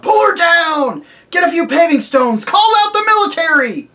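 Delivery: loud, high furious yelling from the mob; angry crowd roar. Acted. The crowd yelling at the witch, loud and furious. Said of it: "There came an angry roar from the crowd".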